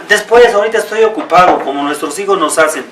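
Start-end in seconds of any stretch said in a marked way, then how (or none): 0.1-1.6 mock busy excuse, quick and higher; brushing off a call. Preacher mimics a son brushing off his father, quick and higher. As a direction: lightly play the child who can't be bothered, quick and higher. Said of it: "Vean, no dijo".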